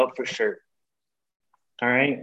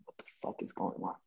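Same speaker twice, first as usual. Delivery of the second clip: much softer, breathy whispered disbelief; 8 semitones lower, 18 dB quieter than usual. Retold or imitated. Waiting outside her house, muttering to himself in a whisper. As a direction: whisper it to yourself, stunned.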